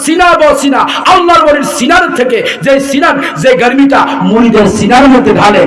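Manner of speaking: high, loud preaching over music; full-force preaching. Acted. Preacher explains heart-to-heart knowledge, at full force. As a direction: preach it out with fervour.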